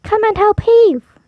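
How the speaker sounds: high, loud call to heave; calling for help. Acted. Dog calling the cat to help pull. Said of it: "called the dog to a cat".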